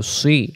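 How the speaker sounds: সি as a heavy "swi"; rounded sad. Shown as a demonstration. Teacher demonstrating sad with kasra, rounded sound. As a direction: purse the lips into সি. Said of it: "মুখটা গোল করে".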